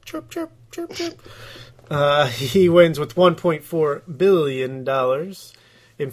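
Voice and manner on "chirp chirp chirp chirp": high chirping bird noises; parakeet chatter. Pretend. Mocking silence after question.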